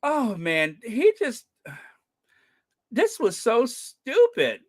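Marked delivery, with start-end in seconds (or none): none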